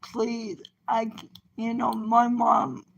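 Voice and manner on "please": very soft retold prayer; retold tearful plea. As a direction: recall a tearful plea, voice dropped low in volume. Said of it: "and crying".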